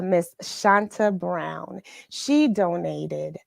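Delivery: ordinary delivery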